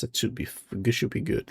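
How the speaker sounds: soft, unvoiced muttering to self; whispered mutter. Real, not acted. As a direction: whisper it under your breath. Muttering to self while setting up.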